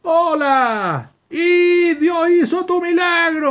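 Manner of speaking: high, drawn-out holler from far off; imitated distant shout. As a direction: call out across the street, stretching the words. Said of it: "me grita".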